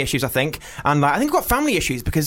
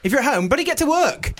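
higher-pitched playful telling-off; pitched-up, jokey ticking-off; mock scolding; 8 semitones higher than usual. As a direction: tease the slacker with mock indignation. Mock scolding of listeners at home.

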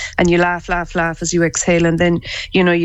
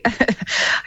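soft laugh; 4 semitones higher, 4 dB quieter than usual. Real, not acted. Guest laughs again.

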